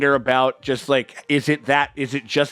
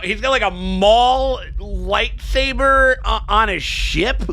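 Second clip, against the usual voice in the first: excited; 10 semitones higher, 4 dB louder than usual. Real, not acted. Excited about lightsaber on screen.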